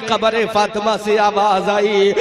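usual speaking voice

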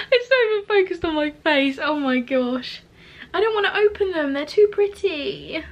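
high, loud delighted gush; giddy, high-pitched delight; overjoyed. Real, not acted. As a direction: gush as if it's too lovely to bear. Overjoyed at her newly arrived products.

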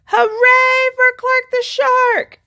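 high, loud, slow cheer. Acted. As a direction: cheer it out for the hero. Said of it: "everyone cheered".

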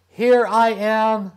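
loud, high call for help; calling out to be found; shout. A narrator's reading. Tin soldier calls to the maid and boy, shouting high. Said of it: "If the tin soldier had shouted".

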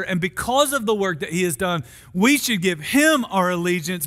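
ordinary talk

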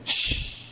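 drawn-out shush; hushing shh. Retold or imitated. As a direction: silence them with a shush. Acted Jesus hushing the people.

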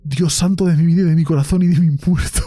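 loud startled cry of shock; real fright. Real, not acted. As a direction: blurt it out right after a real scare. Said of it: "eso me ha asustado bastante".

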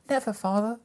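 put-on children crying out together, high and loud. A narrator's reading. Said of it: "they all cried".

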